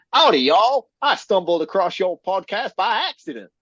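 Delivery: badly faked cowboy Deep South accent. Podcast co-host reads a listener's review in a mock Southern accent. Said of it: "Deep South accent".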